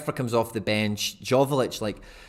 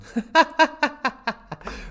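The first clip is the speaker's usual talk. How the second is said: long, loud, high-pitched laugh; high laughter; 6 dB louder than usual. Real, not acted. Streamer laughing at their own babbling, high laughter. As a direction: burst out giggling loudly.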